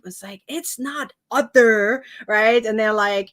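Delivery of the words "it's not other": louder, drawn-out "other"; slowly and emphatically sound out "other", mimicking a corrector; mock correction. Speaker imitating YouTube commenters correcting pronunciation, emphatic "other". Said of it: "when I get comments on YouTube".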